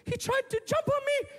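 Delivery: very high little-daughter voice; squeaky-high mock daughter telling her story; upset daughter. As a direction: put on a high-pitched upset girl. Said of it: "she's looking all dishevelled and upset".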